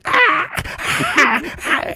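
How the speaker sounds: high squawking bird cries; imitating a bird crying out high; flamingo squawk. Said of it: "starts going".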